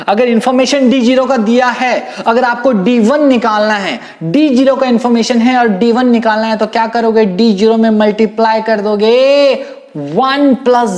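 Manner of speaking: high, loud teaching voice; emphatic loud drilling. Real, not acted. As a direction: belt out the rule to the whole class.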